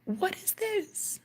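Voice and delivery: high, emotional voice; high and wondrous, near tears; awestruck. Real, not acted. Telling viewers of a life-changing moment, high and emotional. Overwhelmed by the experience.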